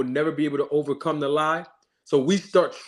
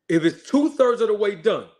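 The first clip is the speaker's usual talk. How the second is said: ordinary talk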